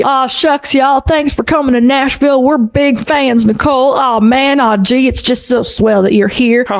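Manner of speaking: mock Southern charm, lightly done; Southern aw-shucks. Imitating [name] greeting her in mild Southern charm. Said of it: "almost Southern preacher persona".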